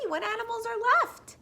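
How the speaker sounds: usual speaking voice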